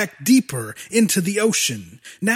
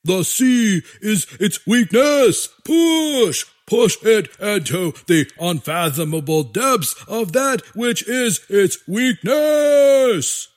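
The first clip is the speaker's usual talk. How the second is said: slow, shouted fanatic orders; rally the troops like a tyrant; dictator speech. Acted. General orders the attack.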